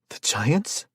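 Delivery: high, catching at the end. Acted. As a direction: voice breaks on the last word. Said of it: "her voice catching at the end".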